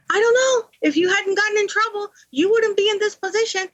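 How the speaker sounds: mother brushing off her daughter on the phone, high and dismissive; imitating mom. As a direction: toss the blame back like an exasperated mother. Mother voiced in phone call.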